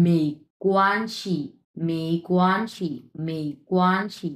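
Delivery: "মেই কোয়ানশি" slowly, syllables separated; careful model reading. Shown as a demonstration. Teacher reads out the next word, slow and careful. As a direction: draw out "মেই" and "কোয়ান", let "শি" fall. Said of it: "এই যেটা পড়ি".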